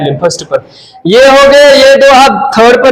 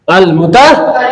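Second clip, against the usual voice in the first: ordinary talk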